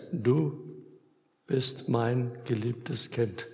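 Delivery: slow, soft, intimate promise; tender assurance. Acted. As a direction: speak each word gently and slowly to a beloved child. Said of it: "sagt Jesus zu dir".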